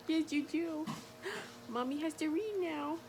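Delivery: high, soft talk to a pet. Real, not acted. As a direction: coo like a mommy to her cat. Talking to her pet.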